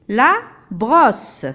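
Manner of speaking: brosse with the S hissed sharply; double S. Shown as a demonstration. Teacher showing brosse, hissed double S. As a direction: hiss the S in brosse. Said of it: "deux S, je prononce comme S".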